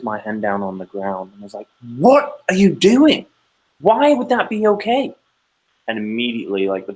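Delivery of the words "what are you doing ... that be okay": loud, high, drawn-out scolding; angry parental outburst. Retold or imitated. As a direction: yell it in outraged disbelief, stretching the words.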